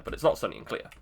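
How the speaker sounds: ordinary talk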